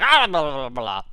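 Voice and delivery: loud blubber that falls away; disgusted sputtering. Retold or imitated. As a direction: splutter in mock disgust. Sputters out disliked squash.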